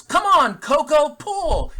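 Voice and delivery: loud, high holler at the horse; retold farmer bellowing at his horse; farmer's yell. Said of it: "the farmer yells".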